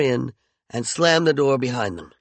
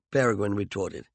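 plain reading voice

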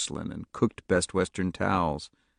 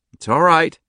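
shaky comfort; 10 semitones higher, 9 dB louder than usual. A narrator's reading. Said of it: "he said shakily".

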